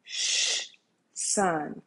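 long shush, then a soft, low word; hushed whisper. Acted. The Lord privately calling daughter and son, in a hushed whisper. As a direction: hush them gently, voice dropped right down. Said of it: "I'm in the whisper".

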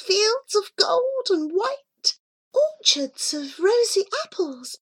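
very high small creature voice, full of wonder; marvelling Miss Curry. A narrator's reading. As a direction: squeak it high, in awe. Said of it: "marvelled Miss Curry".

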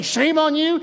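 imagined scolding master, hammed up; mock scolding. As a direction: wag a finger at the disciple. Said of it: "He doesn't look at him and say".

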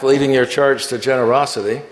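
normal talking voice

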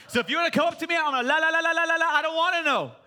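shouted, high la la la, ears covered; loud la-la refusal. Pretend. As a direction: drown out the truth with a loud sing-song la la la.

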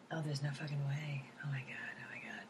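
hushed, low muttering to yourself in disbelief; panicked whisper. Retold or imitated. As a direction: whisper your private dread at an awards show. Inner panic at the awards retold.

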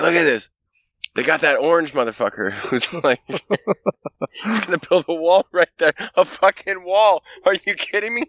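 very high, laughing alien disbelief; mock alien laughing. Alien laughing at the orange man's wall, squeaky mock voice. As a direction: cackle at the silly wall.

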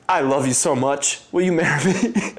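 rising into a high, nervous blurt; blurted proposal. Retold or imitated. Man proposing on one knee, blurting it out nervously. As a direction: blurt it out, overwhelmed. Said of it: "all that could come out of my mouth".

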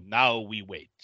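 low, ominous priest line; grave priest. Retold or imitated. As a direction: say it darkly, low. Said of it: "the priests go".

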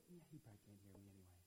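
mumbled aside. Retold or imitated. Blind man giving up on being heard, muttering. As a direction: mutter it under your breath. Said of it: "they're like".

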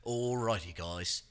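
lightly done Australian greeting; a hint of an Aussie cook opening a video; Australian TikTok chef. Friend imitating the Australian TikTok cook's opener, lightly accented. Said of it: "he starts every episode with".

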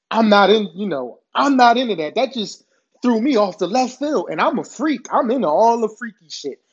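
ordinary talk

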